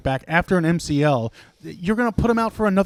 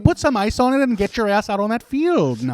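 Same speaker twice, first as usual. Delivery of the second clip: high, folksy Wisconsin voice turning gruff; mock Wisconsin accent; 6 semitones higher, 3 dB louder than usual. Co-host playing a Wisconsin coach scolding Jordan Love. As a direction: fake sympathy then order him out. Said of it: "went back and forth with Wisconsin accents".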